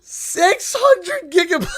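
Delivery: high, loud and slow through laughter; laughing shout. Real, not acted. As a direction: cry it out laughing in disbelief. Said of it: "I'm laughing".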